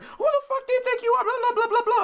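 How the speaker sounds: loud high-pitched laughing mockery of the old lady; mocking old lady. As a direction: squeal her outrage back as a cartoon. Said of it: "And she's like".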